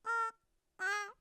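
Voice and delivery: two short high honks; goose honk. Acted. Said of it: "The goose honked twice".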